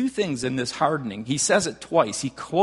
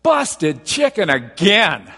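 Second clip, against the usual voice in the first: put-on slow, drawn-out whiny child, much louder; whiny kid; 7 dB louder, much slower than usual. Pastor imitating a nephew complaining about Sunday dinner, slow and whiny. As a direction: groan it out like a bored kid in the car. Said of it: "their kid in the back goes".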